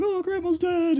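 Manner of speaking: hammed-up high wail of grief; mock crying. Family at grandma's funeral, mock wailing grief. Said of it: "we're crying".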